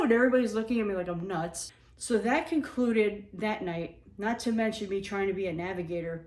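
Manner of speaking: usual speaking voice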